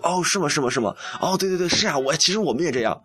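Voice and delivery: high-pitched, put-on knowing agreement; feigned composure. Bluffing to a TV station peer, feigned composure. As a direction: pretend you know it all already. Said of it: "我就还要装得很， 就是很那个淡定".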